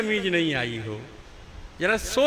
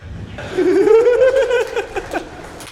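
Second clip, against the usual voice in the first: big high burst of laughter; loud high laugh at his own punchline; hearty laugh; 9 dB louder than usual. Real, not acted.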